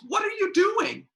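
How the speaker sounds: very high, loud outraged yell; furious shriek. Acted. [name] yelling at [name] over her taco stand, furious. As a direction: scream it, betrayed.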